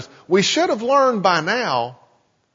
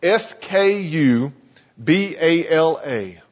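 slow, each letter separate: S K U B A L A; letters S K U B A L A read slowly and distinctly; spelled out letter by letter; slower than usual. Shown as a demonstration. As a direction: spell it out slowly for note-takers. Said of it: "a word that's spelled".

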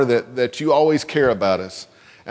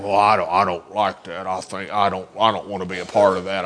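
low, sulky complaining grumble; grumbling; 9 semitones lower than usual. Shown as a demonstration. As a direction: grumble like a sulky kid, lower. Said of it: "that's grumbling".

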